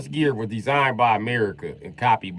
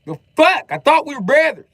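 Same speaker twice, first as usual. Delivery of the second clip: high, loud cry of betrayal; comic indignant shouting; mock-outraged yell; 10 dB louder than usual. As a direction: protest loudly, hammed up. Mock betrayal after teasing.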